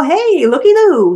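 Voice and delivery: looky-loo, stretched and delighted; excited discovery. A narrator's reading. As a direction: sing it out like spotting a prize. Drone spots the donkey.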